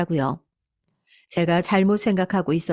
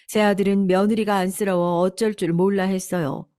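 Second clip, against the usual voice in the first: usual speaking voice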